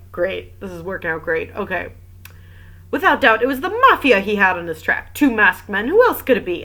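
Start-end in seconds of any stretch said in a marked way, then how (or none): 2.9-6.6 high, loud theatrical certainty; worked-up, emphatic accusation; excited insistence. Acted. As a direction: insist on it with rising drama. Maid insists on mafia theory.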